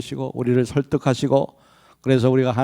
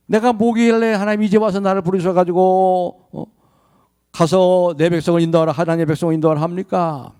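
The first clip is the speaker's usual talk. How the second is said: raised, higher pitched objection; indignant protest; 6 semitones higher, 3 dB louder than usual. Acted. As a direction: push back at God, incredulous. Moses voiced protesting to God. (하나님께 따지는 높은 목소리)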